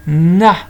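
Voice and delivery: "Na" loud with a lengthened n; "Na" said loudly as nnn-a; sounding out syllable. Shown as a demonstration. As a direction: lean on the n of "Na", then open to a. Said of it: "Qu'est-ce qu'on entend?".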